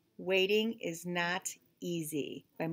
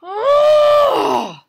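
high, loud exclamation; startled cry; 17 dB louder than usual. Acted. Gerald cries out in frustration, high loud. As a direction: gasp out a sudden cry.

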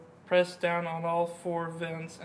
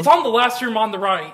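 louder, stating it plainly; loud and clear; 4 semitones higher, 12 dB louder than usual. Real, not acted. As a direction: say it out loud this time. Repeats whispered info out loud.

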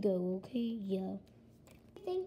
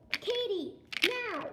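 calling out; 8 semitones higher, slower than usual. Acted. Dad calls for Katie.

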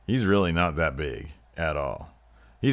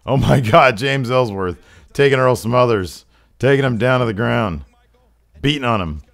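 raised, louder ringside commentary; excited play-by-play; 6 semitones higher, 8 dB louder than usual. Acted. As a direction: call the beatdown with rising alarm. Play-by-play of a takedown.

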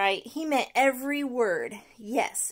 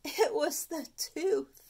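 slow, drawn-out excuse, higher; Stuart's refrain; 5 semitones higher, slower than usual. Acted. Stuart blaming his sweet tooth, slow and whiny. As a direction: blame it on the tooth, slowly.

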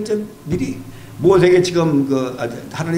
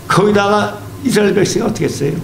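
ordinary talk

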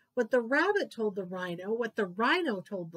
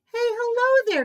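high, loud happy greeting call; cried out; 10 dB louder than usual. A narrator's reading. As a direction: call out a joyful hello across the jungle. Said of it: "cried old Jumbo".

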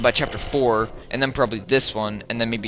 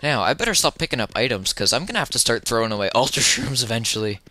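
ordinary delivery

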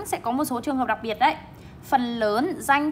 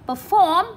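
per-FORM, raised strong second syllable; stress on second syllable; 6 semitones higher, 5 dB louder than usual. Shown as a demonstration. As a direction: perform with FORM high and loud. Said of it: "trọng âm số hai".

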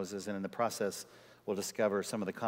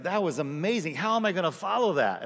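higher and louder, mock amazement, building to the question; amazed friend; 6 semitones higher, 5 dB louder than usual. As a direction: gush like an impressed colleague. Friend marvels at his sermon.

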